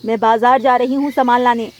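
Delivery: loud, scolding mother; stern scolding. Acted. Mother orders son indoors. (ऊँची आवाज़ में माँ की सख़्त डाँट)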